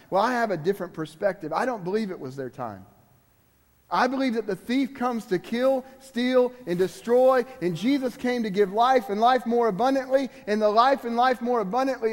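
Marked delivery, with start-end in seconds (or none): 3.9-12.1 higher, louder preaching climb; rising pulpit cadence; building preaching. Acted. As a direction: preach it, building to full force.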